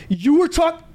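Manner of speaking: high, sudden yell cut short; mock yell. Angry brother yelling at the teller. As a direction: start yelling then stop dead.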